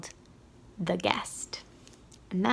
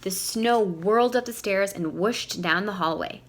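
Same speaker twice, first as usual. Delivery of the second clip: ordinary delivery, nothing marked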